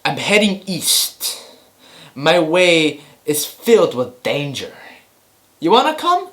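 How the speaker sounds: slow, dramatic hero voice; hammed-up epic invitation; mock trailer line. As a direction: play the grave quest-giver, then a high question. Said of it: "they're just like".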